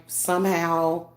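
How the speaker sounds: ordinary talk, nothing marked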